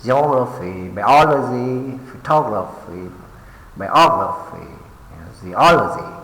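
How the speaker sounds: geography, biology, photography, biography, zoology, each punched on second syllable; ge-OG-raphy, bi-OL-ogy, pho-TOG-raphy, bi-OG-raphy, zo-OL-ogy; focus on stressed syllable. Shown as a demonstration. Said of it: "Focus on stress syllable".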